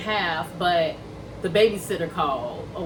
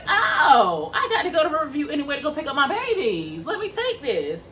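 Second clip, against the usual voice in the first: bright, quick and high, pleased at the lucky ride; happy surprise, talking fast; excited; 6 semitones higher than usual. Retold or imitated. Driver spotting a Riverview ride, excitedly pleased. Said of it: "I was like".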